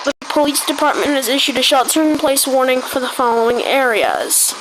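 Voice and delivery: news-anchor alert read, steady and formal; mock emergency broadcast. Gamer announcing a pretend disaster to the server, announcer voice.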